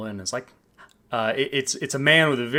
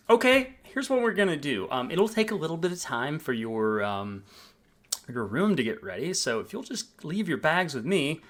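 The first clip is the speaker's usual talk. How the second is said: bright, high, eager front desk voice; a cheerful clerk welcoming a guest; chipper hotel clerk; 5 semitones higher than usual. Acted. Receptionist greets the guest checking in, high and cheerful.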